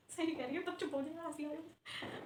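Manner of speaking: very soft, under the breath; hushed aside. Acted. Aside about laughing in class, hushed. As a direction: slip it in quietly, off to the side.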